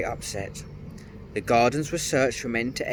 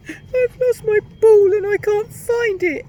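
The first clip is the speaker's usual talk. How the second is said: very high, loud wailing cry; wailing princess; 12 dB louder than usual. A narrator's reading. Princess crying to the gnome about her lost ball, wailing. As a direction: wail it out like a heartbroken little girl. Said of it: "flung herself down on the grass and wailed loudly".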